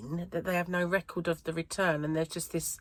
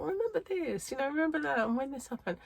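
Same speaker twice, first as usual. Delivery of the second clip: voicing the little inner voice, pitched higher; 8 semitones higher, 3 dB quieter than usual. Reminding herself in her inner monologue, pitched higher. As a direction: lightly play the nagging voice in your head. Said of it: "little voice".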